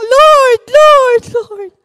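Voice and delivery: high, loud call, fading on the last Lord; desperate child's cry, "Lord" repeated. Retold or imitated. Child calling on God in panic.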